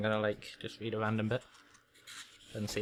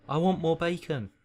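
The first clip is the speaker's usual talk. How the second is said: put-on higher-pitched greedy boy voice; demanding son; 6 semitones higher than usual. Son at breakfast, demanding character voice. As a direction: whine for food in a put-on voice.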